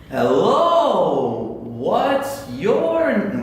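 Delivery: loud, drawn-out mock greeting; exaggeratedly slow. Shown as a demonstration. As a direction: stretch every word as if the listener can't understand. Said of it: "We don't talk like that".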